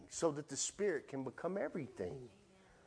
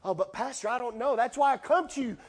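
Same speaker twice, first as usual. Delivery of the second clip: mock congregant; 5 semitones higher, 4 dB louder than usual. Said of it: "mock congregant pleading".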